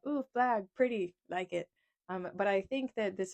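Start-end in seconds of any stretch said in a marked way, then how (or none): 0.1-1.7 naive delight. Retold or imitated. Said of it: "I just know that I was like".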